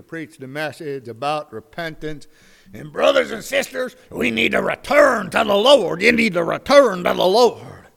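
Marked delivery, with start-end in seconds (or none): 2.7-7.9 loud, higher preacher voice calling the congregation back; revival preacher. Acted. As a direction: belt it out like a country preacher at the altar.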